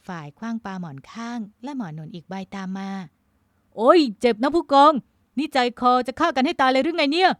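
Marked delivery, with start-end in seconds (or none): 3.3-7.3 loud, high-pitched protest; shrieked comic complaint; startled yelp. A narrator's reading. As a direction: yell out as pillows hit you. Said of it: "ก่อนจะร้องเสียงหลง".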